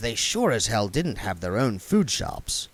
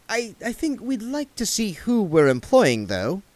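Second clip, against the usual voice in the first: put-on higher, nervous young man; hesitant young editor asking politely; William voiced; 10 semitones higher than usual. A narrator's reading. William asking to see the photographer, a touch hesitant. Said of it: "William said aloud".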